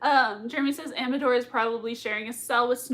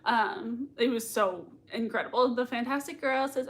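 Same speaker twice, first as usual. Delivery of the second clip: normal talking voice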